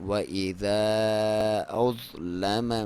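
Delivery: slow drawn-out tajweed chant; Quran recitation. Shown as a demonstration. Teacher recites the verse for students, slow chant. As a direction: recite it slowly with full tajweed. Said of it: "چاہیں تو اسے پڑھیں".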